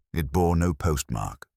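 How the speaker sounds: ordinary delivery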